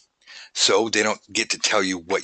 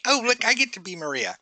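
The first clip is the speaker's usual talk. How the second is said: mock gloating understudy; 12 semitones higher, faster than usual. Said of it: "pull a Tonya Harding and like".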